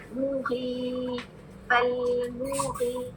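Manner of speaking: फल मुगी drawn out slowly; slow recitation demo. Shown as a demonstration. Teacher models the word for the class. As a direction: stretch फल मुगी with long vowels. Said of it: "ये कितना पुल करना है".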